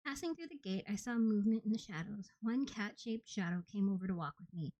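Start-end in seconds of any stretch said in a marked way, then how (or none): none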